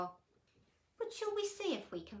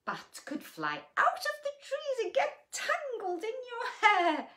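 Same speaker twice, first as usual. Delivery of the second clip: mock spooky; 13 semitones higher, 10 dB louder than usual. A narrator's reading. Spooky bats line.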